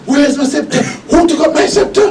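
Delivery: high, frantic and fearful; frightened pharaoh voice. Retold or imitated. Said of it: "I'm speaking with a fear of voice".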